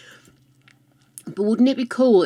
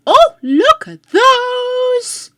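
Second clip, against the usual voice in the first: excited; 8 dB louder, much slower than usual. Real, not acted. Delighted gasp at Sims items.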